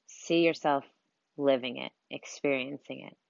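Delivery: soft, unhurried meditation voice with long pauses; guided meditation. Acted. Coach leads listeners through breathing and visualizing their dream life, guided meditation. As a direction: guide them gently through breathing and imagining, softly.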